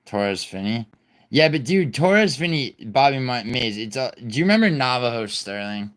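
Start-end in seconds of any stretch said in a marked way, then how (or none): none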